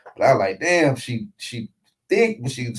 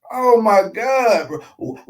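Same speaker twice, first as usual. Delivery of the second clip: normal talking voice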